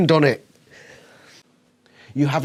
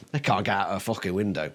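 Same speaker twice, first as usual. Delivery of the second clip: soft, lower smug imitation of Rachel; mock smug Rachel; 6 semitones lower, 7 dB quieter than usual. Mocking Rachel crawling out the window, smug murmur. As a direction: mimic her dumb confidence, murmured.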